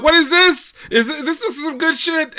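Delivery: high, eager questions; retold excitement. Cohost retelling the Gundam game: high eager hype. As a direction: get hyped up at the screen. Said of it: "I'm like".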